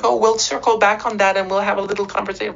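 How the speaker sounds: high mocking voice of a smug official. Speaker mocking American officials' excuse, high mock voice. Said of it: "don't give me this shit that".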